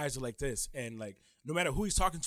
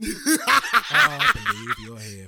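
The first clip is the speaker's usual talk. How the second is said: slow, raised British villain voice; Klaus; 5 semitones higher, 6 dB louder, much slower than usual. Acted. Smirking British vampire confronts someone, slow menacing British accent. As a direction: draw it out loudly in a British accent. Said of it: "the accent, the, the British shit".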